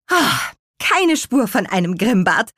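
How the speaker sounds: high sigh of relief, then a bright call; relieved exclamation. A narrator's reading. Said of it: "rief Petronella erleichtert".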